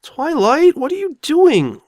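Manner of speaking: high, loud and slow call after her. Acted. Spike calls after Twilight as she leaves, loud. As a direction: shout after a friend who is walking away.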